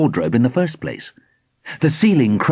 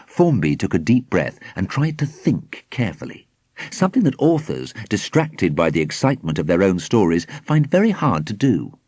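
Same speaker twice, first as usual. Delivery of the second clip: ordinary delivery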